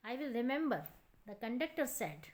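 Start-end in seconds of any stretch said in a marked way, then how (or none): none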